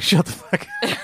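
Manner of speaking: high, snappy mock rebuke; mock snappy retort. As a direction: snap it out in a put-on voice. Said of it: "she's like".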